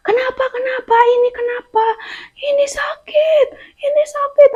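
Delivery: high, thin, panicked voice; panicked pain. Retold or imitated. Telling her sister in the bathroom where it hurts, high panicked voice.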